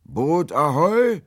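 long, high, loud sea hail; hailing shout. A narrator's reading. Will hails the vanished boat, shouting through cupped hands. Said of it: "legte die Hände wie einen Trichter an die Lippen und schrie".

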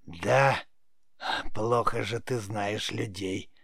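put-on slow, rasping old-woman witch voice; creaky old witch. A narrator's reading. Witch scoffs at the boy's idea of people, slow creaky rasp. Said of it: "проскрипела ведьма".

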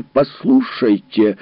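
slow, loud exasperated cry; losing temper. Acted. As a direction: Foster loses his temper, loud. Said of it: "выйдя из себя".

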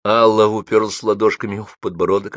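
ordinary delivery

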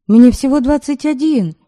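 plain reading voice

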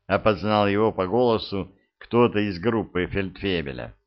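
usual speaking voice

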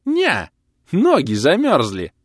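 high, gleeful punchline; triumphant. A narrator's reading. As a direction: deliver it high and slow in triumph. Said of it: "торжествующе произнёс сосед".

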